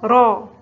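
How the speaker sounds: loud, clear announcement of را; loud teacher naming the letter را; letter announced. Shown as a demonstration. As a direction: call out را to the class. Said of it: "حرف الراء محرك بحركة الفتح".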